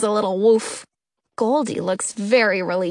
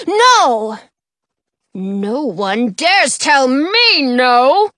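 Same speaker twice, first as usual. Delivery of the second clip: outraged king; 6 dB louder, much slower than usual. A narrator's reading.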